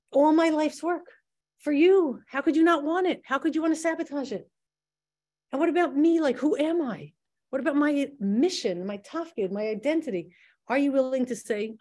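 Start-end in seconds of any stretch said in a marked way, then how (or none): 0.1-10.3 higher, pleading cry of disbelief; anguished inner protest. Retold or imitated. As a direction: plead with God as the mind spins in panic. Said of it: "your mind is going crazy saying".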